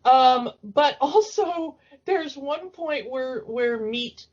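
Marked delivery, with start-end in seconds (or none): none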